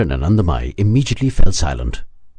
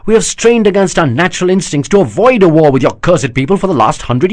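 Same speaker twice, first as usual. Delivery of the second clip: an outraged prince, voice raised and pitched up; higher, louder bitter defence against the charge; indignant prince; 9 semitones higher, 5 dB louder than usual. Acted. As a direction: protest the insult, growing heated. Chandravanshi prince defends his people.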